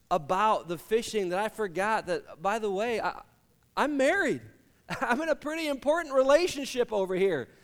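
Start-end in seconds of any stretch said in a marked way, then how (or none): 3.8-7.5 higher, laughing self-mockery; laughing realization. Real, not acted. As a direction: laugh at your own blunder.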